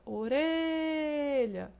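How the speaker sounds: stretched second-to-last syllable in orelha; stress on middle RE. Shown as a demonstration. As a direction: hold the RE of orelha high, then drop. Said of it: "encontra-se na penúltima sílaba".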